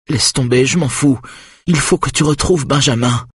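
high, insistent woman's voice; desperate urging. A narrator's reading. Mother begs husband to find Benjamin, high and desperate. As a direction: plead urgently, pitched high.

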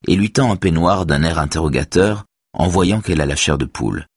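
ordinary delivery, nothing marked

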